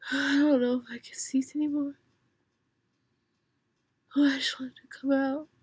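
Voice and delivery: fast urgent whisper; hurried breathy whisper. Real, not acted. Straining to sneeze, whispering.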